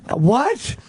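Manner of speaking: high, incredulous exclamation; mock shock. Partner hearing a cheating confession, mock shock. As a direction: play a stunned, high-pitched what.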